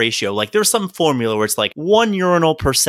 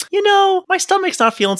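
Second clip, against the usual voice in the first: whiny patient. Retold or imitated. Patient calling in sick.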